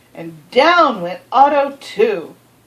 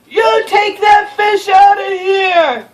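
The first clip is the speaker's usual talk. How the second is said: loud, high, slow angry shout; angry yell; 9 semitones higher, 5 dB louder, slower than usual. Acted. Swimmers yelling angrily at the boy, loud and high. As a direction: drive them off with a shout. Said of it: "they yelled".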